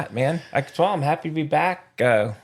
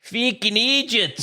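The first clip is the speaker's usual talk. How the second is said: put-on Freaking idiots pushed higher and harder in a British accent, slow; louder British accent; 9 semitones higher, slower than usual. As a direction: punch it out in a mock British accent. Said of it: "you don't have to say it louder".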